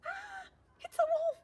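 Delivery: high-pitched alarmed shout; shocked shout. Acted. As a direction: shriek as the bunnies would. Bunnies discover the wolf.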